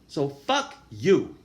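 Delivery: slow, loud, deliberate fuck you; defiant. Real, not acted. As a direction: spit each word out slowly. Defiant retort to haters.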